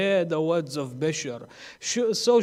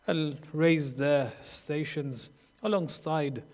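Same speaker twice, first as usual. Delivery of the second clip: soft, lower supplication; closing prayer; 4 semitones lower, 6 dB quieter than usual. Acted. Preacher leading the congregation in prayer, soft and low. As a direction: pray quietly and humbly, winding down.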